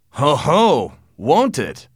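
put-on old father shouting down his son's claim; shouted. A narrator's reading. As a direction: bellow it back at him with scorn. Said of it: "shouted Anthony".

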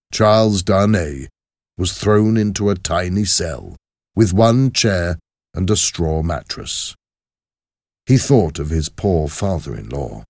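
ordinary delivery

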